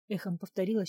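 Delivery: usual speaking voice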